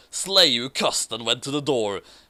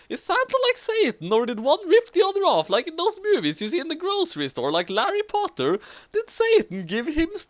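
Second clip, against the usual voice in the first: excited high rant; 12 semitones higher than usual. Acted. Voiced character rant.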